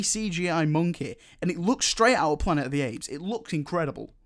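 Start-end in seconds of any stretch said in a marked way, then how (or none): none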